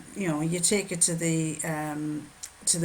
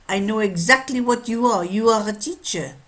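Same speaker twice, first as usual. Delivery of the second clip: put-on loud, higher French-accented watchmaker; 3 semitones higher, 7 dB louder than usual. French watchmaker telling a customer what she does, French accent. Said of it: "a French watchmaker".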